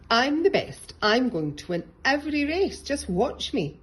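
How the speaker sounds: loud, high bragging; boasting. A narrator's reading. Rabbit boasting loudly to her classmates. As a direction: crow about being the best. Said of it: "boasted Rabbit".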